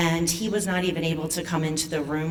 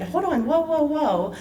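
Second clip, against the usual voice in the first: retold startled husband, much higher; husband alarmed; 9 semitones higher than usual. Husband on the phone hearing the news, startled. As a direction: stop her mid-sentence, alarmed. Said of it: "And he said".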